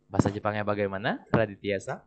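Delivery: ordinary talk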